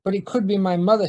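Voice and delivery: fast, pleading excuse; husband quickly defending to wife, fast; hurried excuse. Acted. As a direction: rush out the excuse. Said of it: "says Ned".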